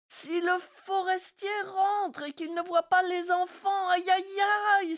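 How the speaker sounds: high, loud, alarmed outcry; panicked cry. Acted. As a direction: cry out in panic that the children are gone. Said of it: "s'écria-t-elle".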